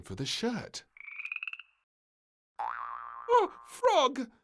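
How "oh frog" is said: high, loud startled shriek. Acted. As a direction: shriek in fright. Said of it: "she shrieked".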